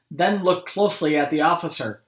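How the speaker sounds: usual speaking voice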